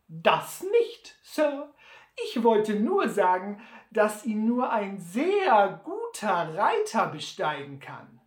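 höherer, lauterer alter Trapper; Sam Hawkins listig. Acted. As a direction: verschmitzt andeuten wie Sam. (Sam stichelt listig gegen Butler)

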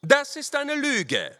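high, slow, forceful accusation; officer flings the charge, drawn out; stern accusation. A narrator's reading. Lieutenant accuses rider of lying, stern.

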